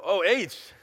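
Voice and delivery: loud, high rallying call to the crowd; call-out cheer. Real, not acted. Preacher rallying the church with a cheer, loud call. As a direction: shout it out to get the congregation cheering.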